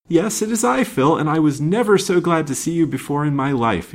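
high, glad outpouring of relief; relieved. A narrator's reading. Stranded man to his best friend, relieved. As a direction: greet your friend with heartfelt relief. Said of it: "in a tone of relief".

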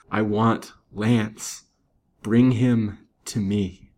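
very slow, halting dying demand; broken, defiant gasp. A narrator's reading. Dying woman demands the man she loves, broken and defiant. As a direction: force the words out one by one, defiantly. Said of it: "gasped in broken yet defiant tones".

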